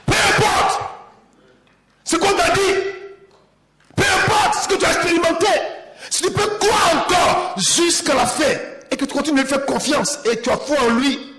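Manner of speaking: high, loud climactic shout; full-force preaching. Acted. Preacher promising testimony to the congregation, shouting. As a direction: drive it home to the crowd.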